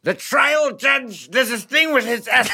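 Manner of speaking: high, strained courtroom impression; Sam Waterston. Comedian shows host his courtroom actor impression, high and drawn out. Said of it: "what was Sam Waterston like?".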